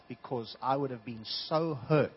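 ordinary talk

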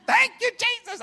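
high, loud, overjoyed praise; joyful old lady. Retold or imitated. Old lady thanking Jesus for the money, high and joyful. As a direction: voice her happy cry of thanks.

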